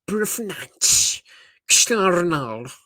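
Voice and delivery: mock Portuguese TikToker drawling the name in a thick accent. Imitating a Portuguese TikTok guy announcing names, thick accent. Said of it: "like, the most thickest accent".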